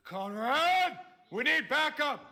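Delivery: high, loud urgent call; urgent shout. Acted. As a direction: call for backup like an emergency. Calling for backup.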